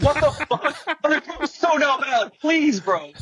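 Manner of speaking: very high, pleading protest; dismayed pleading. Retold or imitated. Worker refused the warehouse job, dismayed pleading. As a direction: plead in disbelief, voice pitched right up.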